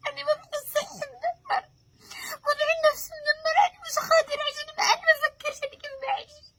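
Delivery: loud, high sobbing cry; crying shout. Real, not acted. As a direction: wail it out, breaking down. Sleepless grieving daughter cries out.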